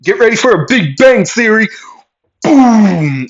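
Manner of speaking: high, loud showman's announcement into a boom; triumphant warning shout. Acted. Character warning before blasting through floor, shouted.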